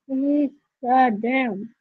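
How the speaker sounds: ordinary delivery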